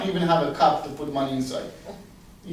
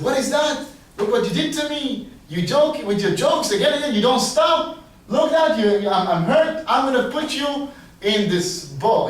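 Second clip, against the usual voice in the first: loud, higher-pitched scolding; hammed-up royal complaint; upset king; 6 semitones higher, 5 dB louder than usual. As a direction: tell him off in acted outrage. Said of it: "The king gets so upset".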